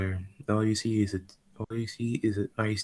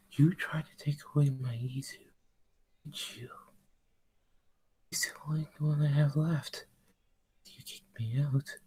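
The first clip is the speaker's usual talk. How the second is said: hissed whisper; 6 semitones higher than usual. Acted. Rose confronts the demons.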